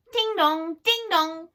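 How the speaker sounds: high, loud ding-dong chime; clock chiming. Acted. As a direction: ring it out like a clock bell. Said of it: "said Silky's clock".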